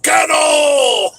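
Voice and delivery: put-on long loud drunken yell; buddies yelling. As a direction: holler the command at the dogs. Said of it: "there's my buddies going".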